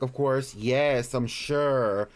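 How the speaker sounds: normal talking voice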